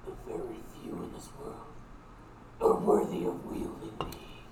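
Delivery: low growling whisper from inside the head; dusty mummy rasp. Acted. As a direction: hiss it like an ancient cursed weapon. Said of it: "you hear the voice again in your head".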